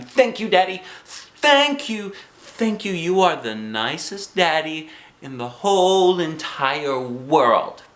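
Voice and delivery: high, gushing thanks; very happy. A narrator's reading. Girl hugs Daddy for the gift, very happy. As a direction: squeal your thanks, settling into slow emphasis. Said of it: "hugged him very happy".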